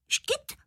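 sudden high hiccup; škytnutí. Acted. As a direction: squeak out one high hiccup. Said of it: "A jak škytnu?". (krátké vysoké škytnutí)

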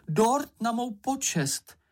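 high, drawn-out dismay; aghast, stretched-out exclamation; exclaiming. A narrator's reading. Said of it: "zvolal Mumínek".

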